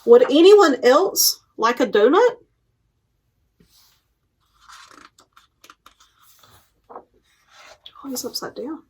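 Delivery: lively picture book read-aloud with paused page turns; reading to children. Acted. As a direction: read it out to a room of little kids, giving each animal a light voice.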